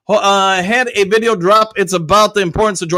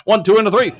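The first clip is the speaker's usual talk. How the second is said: usual speaking voice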